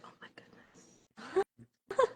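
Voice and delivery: voiceless, drawn-out mock dismay; whispered dismay. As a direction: whisper it slowly with a laugh, retelling your dismay. Retelling dismay at the early alarm.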